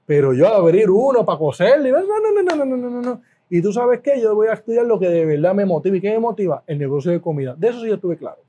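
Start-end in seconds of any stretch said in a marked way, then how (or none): 0.0-3.2 mock-horrified string of high, rapid no's; emphatic refusal. Recalling why he quit veterinary studies, mock-horrified refusal. Acted refusal of surgery on animals.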